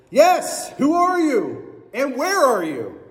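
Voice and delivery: high, urgent, stretched calling; frantic Jack. Acted. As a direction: call out to a voice you can't see. Jack calls to the voice.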